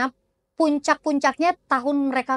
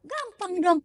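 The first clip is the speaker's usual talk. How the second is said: higher-pitched, lightly cocky client; breezy confidence; 7 semitones higher than usual. Acted. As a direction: toss it off as child's play. Client finds the task easy.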